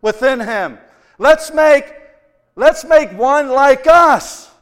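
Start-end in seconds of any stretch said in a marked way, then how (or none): 1.2-4.5 loud, slow, proclaiming as God; booming voice of God. Acted. Preacher voicing God in a loud slow boom. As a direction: boom it out as the Creator.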